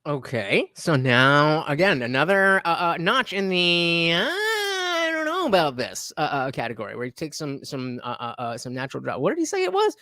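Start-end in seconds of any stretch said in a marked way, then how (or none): 4.1-6.1 slow, high, wavering "I don't know" voice; doubtful mock whine. Streamer mimics his own doubt, slow high whine. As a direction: drag it out like a skeptic pulling a face.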